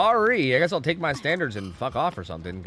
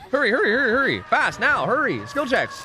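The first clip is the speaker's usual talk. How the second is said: frantic urging; 9 semitones higher than usual. Real, not acted. Racing to finish gen, urging.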